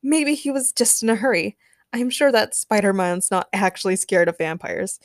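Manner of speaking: ordinary delivery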